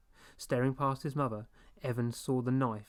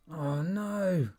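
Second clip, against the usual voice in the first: high, loud horror; horrified; 5 semitones higher, 4 dB louder than usual. A narrator's reading. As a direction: gasp it out in dread. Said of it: "Evan thought in horror".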